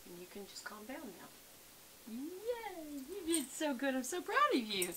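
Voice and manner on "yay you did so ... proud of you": high, bright cheer for the dog; gushing pet praise; excited praise. Real, not acted.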